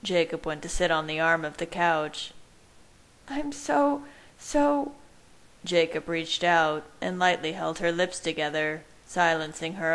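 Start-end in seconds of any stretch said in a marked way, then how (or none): 3.3-4.9 high, halting character voice; Bella's voice. A narrator's reading. Bella starts apologising to Jacob, high and halting. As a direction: begin an apology, pitched well up.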